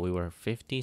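ordinary delivery

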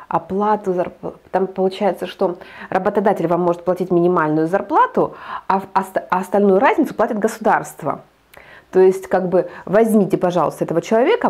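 normal talking voice

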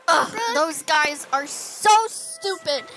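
slow, emphatic disgusted outburst; indignant exasperation. Acted. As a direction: stretch it out, fed up with them. Friend indignant at the boys' prank.

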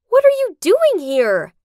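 loud, high, shocked question; startled outburst. A narrator's reading. Finding Tracy locked in the bedroom, startled outburst. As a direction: blurt it out, nearly screaming. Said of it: "I almost screamed".

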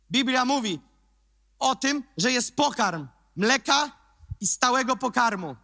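high, loud sermon delivery; impassioned preaching. Acted. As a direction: preach with rising fire. Raised preaching voice. (kaznodzieja przemawia do wiernych, podniesionym głosem)

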